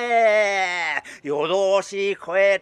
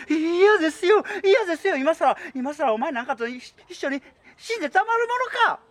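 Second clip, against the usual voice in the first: high tearful shout; crying refusal; 10 semitones higher, faster than usual. Acted. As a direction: wail it back in defiance.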